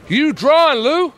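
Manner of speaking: high, loud attempt at Philly accent. Shown as a demonstration. Trying slang on Lou, mock Philly accent. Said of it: "I should do some of your Philly accent".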